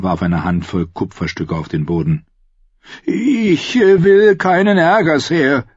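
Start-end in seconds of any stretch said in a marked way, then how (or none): 3.0-5.6 nervous innkeeper. Acted. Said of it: "erwiderte der Gastwirt".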